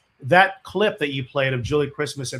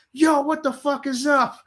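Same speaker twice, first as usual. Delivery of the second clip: stage hype shout; 13 semitones higher than usual. Retold or imitated. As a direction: fire up thousands of fans from the stage. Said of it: "So I go".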